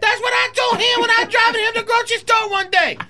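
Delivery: sustained high comic voice; high-pitched voice. Acted. Telling of advice to Uncle Joe, high-pitched. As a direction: hold it high right through the long line. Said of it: "I can't do that high-pitched voice on such a long line".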